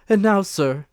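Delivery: high and loud, but controlled. Acted. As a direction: call him to attention with authority. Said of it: "perfectly calm and steady".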